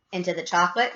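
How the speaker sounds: plain reading voice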